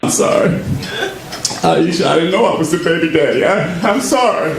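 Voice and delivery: high, whiny pretend sob; mock crying. A man on Maury learns he is the father and mock-sobs. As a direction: blubber the apology like a fool.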